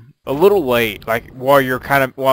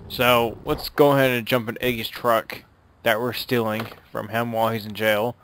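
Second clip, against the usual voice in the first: usual speaking voice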